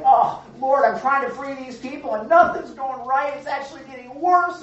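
high, loud exasperated plea to the Lord; mock Moses at his wits' end; frustrated complaint to God. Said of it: "he's all mad at God".

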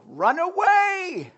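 high, slow comic wail of retreat; hammed-up panicked knight; mock cowardly cry. Christians fleeing trouble, high drawn-out mock wail. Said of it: "That's the motto".

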